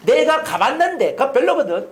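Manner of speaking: high, loud know-it-all dismissal; smug put-down, high and loud; dismissive brag. Retold or imitated. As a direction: shoot the idea down smugly. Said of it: "이러면요".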